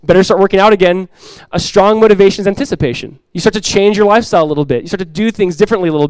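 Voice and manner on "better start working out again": higher, louder mock fretting; mock dread. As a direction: sigh it out in playful alarm. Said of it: "You go".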